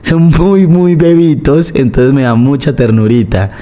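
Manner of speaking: put-on high, slow baby-talk to a little kid; talking to a child. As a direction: coo it out like explaining to a toddler. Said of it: "cómo más explicarle a un niño". (voz aguda y lenta de hablarle a un niño pequeño)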